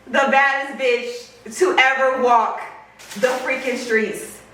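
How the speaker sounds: slow, drawn-out hype introduction; grand announcer. Pretend. Introducing the bag to viewers, grand slow announcement.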